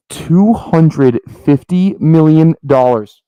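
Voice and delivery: loud, slow, every number hammered out; big reveal. Real, not acted. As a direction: drop the price like a bombshell, slow and loud. Big reveal after wait for it.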